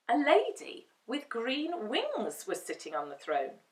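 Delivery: usual speaking voice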